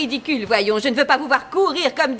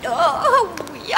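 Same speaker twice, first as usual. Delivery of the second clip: usual speaking voice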